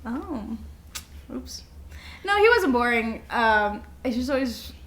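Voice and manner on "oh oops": ordinary talk, nothing marked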